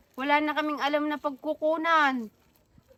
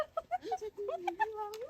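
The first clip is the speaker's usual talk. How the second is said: giggle; 7 semitones higher, 12 dB quieter than usual. Acted. Tickled wife giggling.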